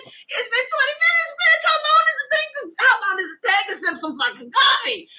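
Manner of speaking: high, loud, fast furious yelling; angry shout. Retold or imitated. Quoted angry partner, acted.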